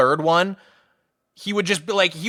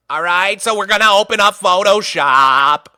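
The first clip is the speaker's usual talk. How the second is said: bleating, louder goat-like voice; billy goat teacher; 3 semitones higher, 5 dB louder than usual. Retold or imitated. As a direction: imitate a teacher who sounds like a goat. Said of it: "this guy sounded like a billy goat".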